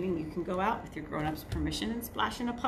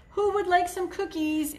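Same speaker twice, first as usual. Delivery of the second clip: much higher, sing-song mother; Mama's voice; 10 semitones higher, 3 dB louder than usual. Acted. Mama offering cookies to the children, high cheery voice. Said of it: "asked Mama".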